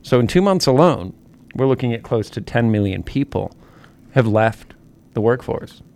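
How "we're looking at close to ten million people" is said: ordinary talk, nothing marked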